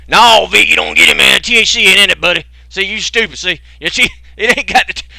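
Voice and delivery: high, loud mocking stoner voice defending his oil. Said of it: "there's at least one or two potheads".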